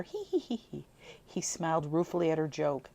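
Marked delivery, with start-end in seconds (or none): 0.0-0.8 put-on spelt-out girlish hee-hee giggle; high tittering laugh. A narrator's reading. Jin giggling at Walter, high tittering laugh. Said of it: "rippling with laughter".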